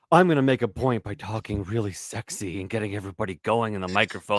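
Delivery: low, husky imitation of a seductive talker; mock sexy voice. Teasing the other host, a low mock sexy purr. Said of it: "You're like".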